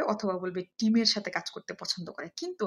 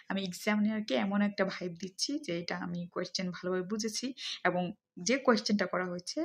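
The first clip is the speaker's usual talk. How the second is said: ordinary talk, nothing marked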